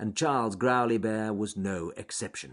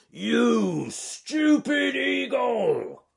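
high, slow, loud bear roar; 14 semitones higher, 7 dB louder, much slower than usual. Acted. Bear roaring at the eagle holding his friend, loud roar. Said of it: "roared Charles Growly Bear".

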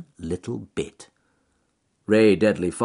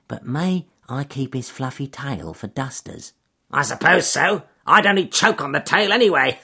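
sly weasel voice; 6 semitones higher, 5 dB louder than usual. Acted. Weasel replies to fox.

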